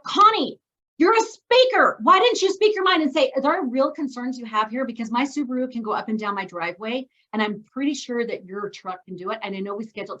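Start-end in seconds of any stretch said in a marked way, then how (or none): 0.0-4.8 much higher, louder, slowed scolding of herself; self-scolding. Retold or imitated. Connie scolding herself after the septic guy leaves, higher and louder. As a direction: berate yourself for staying silent. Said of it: "what I say to myself".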